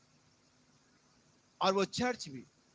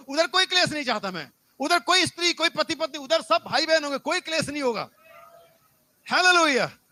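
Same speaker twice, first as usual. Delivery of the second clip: loud, emphatic sermon delivery; full-force preaching; 4 semitones higher, 8 dB louder than usual. Real, not acted. Preacher voices the Lord forbidding strife in heaven, loud preaching. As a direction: lay down the law from the pulpit, loud.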